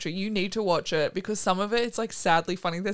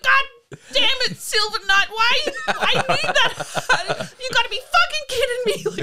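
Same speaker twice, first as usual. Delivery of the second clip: imitating angry yelling; 8 dB louder than usual. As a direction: wail loudly at the thieving fox. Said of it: "yelling about this fox that takes his hat".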